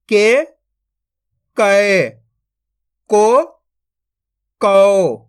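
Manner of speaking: syllable drill, short rising then long level-falling vowels; barahkhadi recitation. Shown as a demonstration. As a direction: recite each syllable apart for pupils to repeat, stretching every second one. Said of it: "मैं किस तरीके से इनको पढ़ रहा हूँ". (बारहखड़ी का पाठ, एक-एक अक्षर खींचकर)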